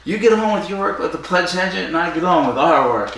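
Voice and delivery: high, breezy mock dismissal; Brazen's cool brush-off. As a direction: wave them off like it's nothing. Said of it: "Brazen's great in this. He's like".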